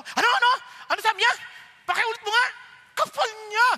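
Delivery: high, loud, offended gossip reaction; indignant outburst. Pretend. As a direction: snap back, insulted and nosy.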